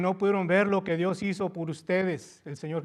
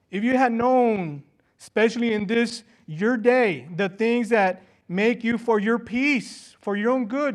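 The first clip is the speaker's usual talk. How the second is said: loud and higher, urgent and pleading; fervent preaching; 4 semitones higher, 7 dB louder than usual. Acted. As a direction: plead with Jerusalem at full force. Preaching Jesus weeping, raised. (predicación ferviente, más aguda y fuerte)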